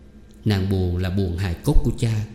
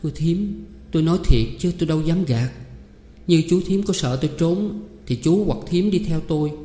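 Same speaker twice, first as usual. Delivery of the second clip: much higher, hurried pleading; earnest girl; 7 semitones higher than usual. A narrator's reading. Girl swears to aunt she isn't cheating, earnest. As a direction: insist you're honest.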